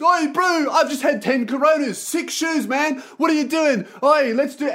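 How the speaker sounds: high, rowdy mock Kiwi; wasted Kiwi guy. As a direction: imitate a drunk bro barging in. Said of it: "And then Kiwi guy comes in".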